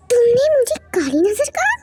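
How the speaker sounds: indignant at being insulted; outraged. Acted. (नाराज़ होकर चौंकते हुए)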